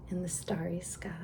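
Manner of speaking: hushed quick whisper. A narrator's reading. Reading at bedtime, a hushed whisper. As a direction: whisper it to the stars.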